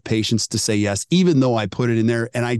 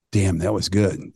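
low, slow admiration; impressed; 6 semitones lower, slower than usual. Retold or imitated. Caller just off the phone, low and slow, impressed. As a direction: let it sink in, low and slow.

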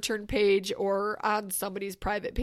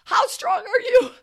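louder trainer shout; 12 semitones higher, 9 dB louder than usual. Retold or imitated. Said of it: "He's like".

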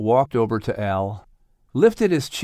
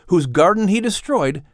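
ordinary delivery

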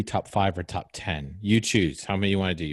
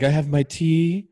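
mock dreamy writer; 6 semitones higher, 4 dB louder than usual. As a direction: swoon like a writer with tea and a bouquet. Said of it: "You're like".

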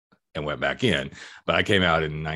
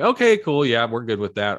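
much higher and louder, gushing; mock cheery acceptance; 6 semitones higher, 7 dB louder than usual. Parents instantly okay with coming out, mock bright cheer. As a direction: play the breezy parent who's totally fine.

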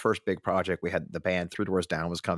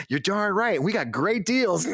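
mock cheery reply; 12 semitones higher, slower than usual. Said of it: "their social media team just replied".

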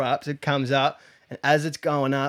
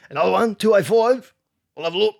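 high, louder put-on voice, rising questions; exaggerated imitation of a mumbling seller; mock dodgy bloke; 7 semitones higher, 6 dB louder, faster than usual. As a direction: ham up the confused tyre seller. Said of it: "wouldn't even say it like that".

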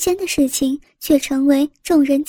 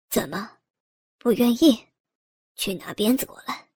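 vicious; 5 semitones lower, 6 dB quieter than usual. Acted. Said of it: "恶狠狠地说道".

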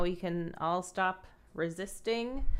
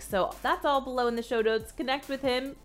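upbeat, higher-pitched host sign-off; podcast outro read; 4 semitones higher than usual. Acted. Host sends listeners to the show notes, bright podcast outro read.

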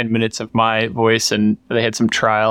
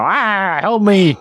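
high, louder whiny robot plea; robot vacuum voice; 8 semitones higher, 3 dB louder, much slower than usual. Retold or imitated. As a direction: plead like a little stuck robot. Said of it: "it would go".

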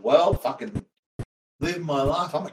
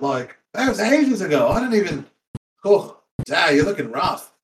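usual speaking voice

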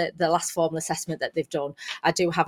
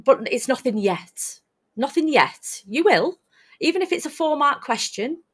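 encouraging teacher; 7 semitones higher than usual. Retold or imitated. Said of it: "And I'm like".

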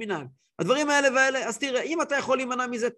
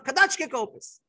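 retold yell; 4 semitones higher than usual. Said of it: "צעק עליו".

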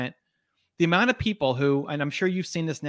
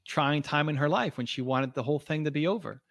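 ordinary talk, nothing marked

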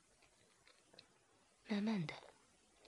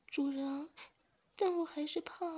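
much higher, timid voice; scared reply; 9 semitones higher than usual. A narrator's reading. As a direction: answer small and frightened. The young man answers, still scared.

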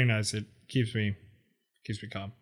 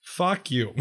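mock hostile; 3 semitones higher than usual. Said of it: "The fuck you was very lovely".

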